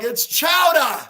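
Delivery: loud mock Boston "chowder", ending in "ah"; Boston-accent shout. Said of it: "New York accents and Boston accent".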